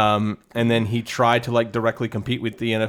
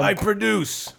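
Trump impression; 8 semitones higher, slower than usual.

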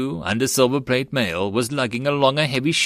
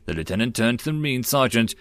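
ordinary delivery

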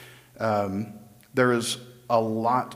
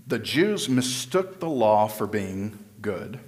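usual speaking voice

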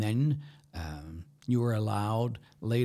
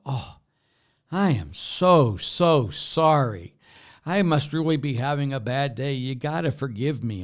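hammed-up apology; 4 semitones higher, 6 dB louder than usual. Priest begs forgiveness after punching you, hammed-up apology. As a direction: lay on the "so sorry" thick.

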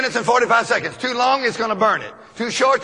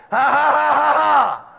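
laughing in faith. Shown as a demonstration. Preacher demonstrates laughing in faith.

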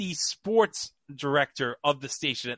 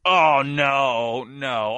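louder mock-dismayed "oh no"; retold dismay; 2 semitones lower, 4 dB louder, much slower than usual. Sports guy fearing he'll get fired, mock dismay. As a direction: groan it out as the worried sports guy. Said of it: "the sports guy's like".

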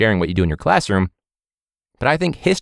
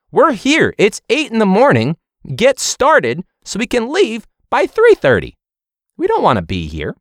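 exasperated; 12 semitones higher, 4 dB louder than usual. Real, not acted. Exasperated retort at presenter.

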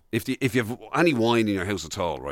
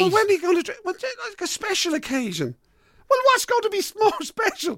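much higher hammed-up wife voice; mock the wife's exasperated question, pitched way up; mimicking wife. Husband mimics his wife asking when the wine will be drunk, high pitched. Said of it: "It's like".

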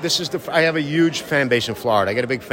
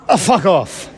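higher, mock-contemptual brush-off; dismissive; 8 semitones higher, 4 dB louder, slower than usual. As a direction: tell them off with a sneer. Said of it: "send a little message to the art world".

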